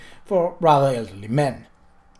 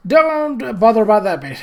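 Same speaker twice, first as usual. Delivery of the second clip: eager, raised and louder; excited; 7 semitones higher, 5 dB louder than usual. A narrator's reading. Groat cuts in about the boots, excitedly. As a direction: blurt it out eagerly. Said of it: "said Groat excitedly".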